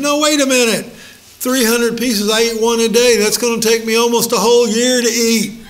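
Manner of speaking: high, loud, worked-up objection; husband protesting to wife about gum; exasperated protest. Retold or imitated. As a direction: count it out in disbelief.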